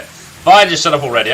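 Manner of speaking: loud fed-up burst on fine, then snapping; a fed-up old warrior barking at the pest; exasperated shout. Acted. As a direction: give in with an angry yell. NPC fed up with begging.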